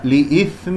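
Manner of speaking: لإثم with nasal ghunna held toward fa; ikhfa before fa. Shown as a demonstration. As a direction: hum the nun into لإثم lightly. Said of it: "فاء".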